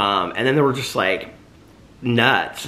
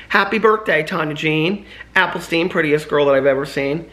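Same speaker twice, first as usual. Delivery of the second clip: excited; 6 semitones higher, 3 dB louder than usual. Real, not acted. Birthday shoutout to a friend.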